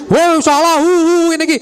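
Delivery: high, mocking classmates' jeer; mock the kid who got it wrong. Classmates jeering, voiced. (ejekan teman sekelas, nada tinggi)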